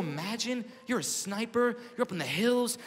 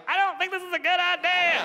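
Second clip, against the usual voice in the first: high, loud nervous plea; a frightened grown man's whimper; scared whine; 6 dB louder than usual. Retold or imitated. Comic on helicopter imagines whining to Marines, high and scared. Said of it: "thirty-year-old man like".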